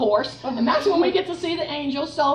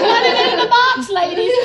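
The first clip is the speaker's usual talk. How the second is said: much higher, much louder laughing call; joyful exhortation; 11 semitones higher, 10 dB louder than usual. Real, not acted. Urging the ladies to pray, laughing and loud. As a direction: cheer them on with a laugh.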